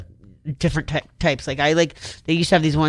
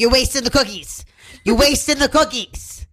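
imitating mom; 8 semitones higher than usual. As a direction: scold like an exasperated mother. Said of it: "when you imitate your mom".

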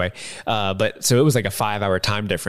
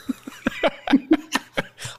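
breathy giggle. Real, not acted. Laughing at own impression.

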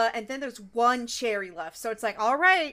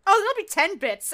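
smug vendor; 8 semitones higher, 6 dB louder than usual. Retold or imitated. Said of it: "And he's like".

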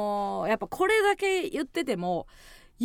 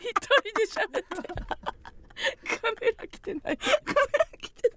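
high and slow, cracking with laughter; after laughing hard; 9 semitones higher, slower than usual. Real, not acted. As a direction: barely get it out for laughing. Helpless laughter retelling. (笑いすぎて声が裏返る)

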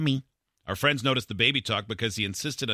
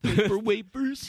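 put-on slow, higher lisping baby voice; baby talk; 10 semitones higher, slower than usual. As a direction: lisp it like a toddler. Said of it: "in baby talk".

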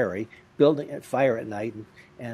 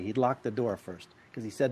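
normal talking voice

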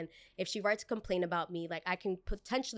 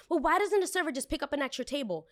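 entitled customer; 6 semitones higher, faster than usual. Retold or imitated. Customer voiced in retold quarrel.

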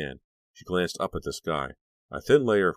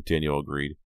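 usual speaking voice

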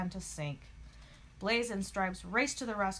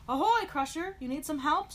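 loud, high hailing call; shout; 8 semitones higher, 7 dB louder than usual. A narrator's reading. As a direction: call out to a rival across the water. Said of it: "shouted Blaze".

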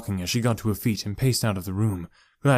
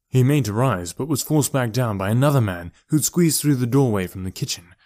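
ordinary delivery, nothing marked

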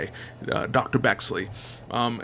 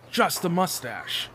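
ordinary talk

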